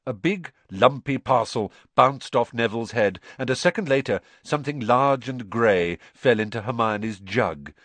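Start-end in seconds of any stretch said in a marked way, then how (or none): none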